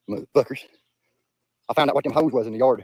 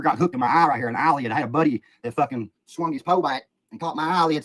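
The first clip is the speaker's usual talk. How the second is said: normal talking voice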